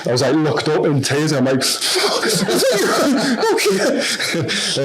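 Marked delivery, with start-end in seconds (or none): 1.7-3.5 high laugh breaking through tears; tearful laugh. Real, not acted. 3.5-4.8 high, laughing okay; laughing through tears. Real, not acted.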